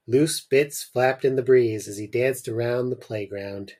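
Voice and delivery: ordinary talk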